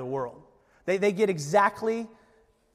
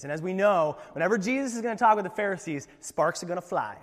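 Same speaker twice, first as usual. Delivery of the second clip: usual speaking voice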